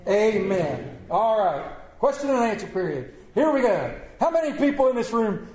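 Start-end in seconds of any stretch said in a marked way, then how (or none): none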